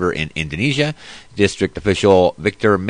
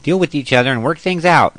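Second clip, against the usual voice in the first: high, meek pleading voice; mock a timid peacemaker; wimpy voice; 9 semitones higher than usual. Mocking a weak president begging others to stop arguing, high and meek. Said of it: "with the voice like that".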